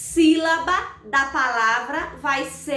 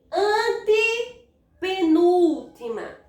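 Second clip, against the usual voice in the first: "antepenúltima" split slowly after "ante"; drawn-out counting; 2 semitones higher, much slower than usual. Shown as a demonstration. Teacher counts syllable positions. (contagem pausada e alongada)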